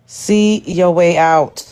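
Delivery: half-speed, each word drawn out; spaced-out, deliberate delivery; slow, pointed dismissal. Real, not acted. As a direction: repeat it slowly and deliberately for emphasis. Repeats the dismissal for emphasis.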